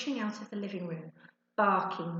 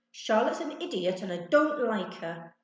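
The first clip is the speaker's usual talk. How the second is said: loud, embarrassed fuming; 3 semitones higher, 7 dB louder than usual. A narrator's reading. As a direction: cover embarrassment with anger. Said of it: "Ben fumed".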